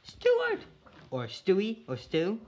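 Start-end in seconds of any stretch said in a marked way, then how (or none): 0.0-0.6 high loud call across the room; calling the dog. Real, not acted. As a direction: call the dog's name out.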